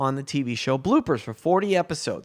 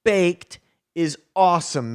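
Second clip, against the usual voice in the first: higher, louder parting call; the fan calls back while leaving, louder; called over the shoulder; 3 semitones higher, 4 dB louder, slower than usual. Retold or imitated. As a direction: shout it back while walking away. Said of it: "As he walks away".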